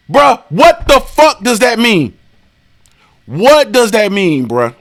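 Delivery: slow, drawn-out questioning; slowed disbelief. Real, not acted. Slowly grilling a commenter, baffled.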